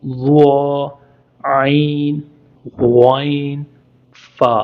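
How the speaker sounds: each Arabic letter said slowly and carefully; letter review. Shown as a demonstration. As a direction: recite the letters one by one. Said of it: "मैं दोबारा से पढ़ देता हूँ". (हर हरफ़ साफ़ और धीरे)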